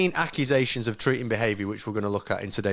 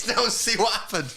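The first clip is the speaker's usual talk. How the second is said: mock outrage; 9 semitones higher than usual.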